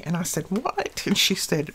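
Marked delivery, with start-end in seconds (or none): 0.5-1.0 high, sudden mock surprise; a quick, higher disbelieving what; surprised what. Retelling her reply to daughter's text, surprised.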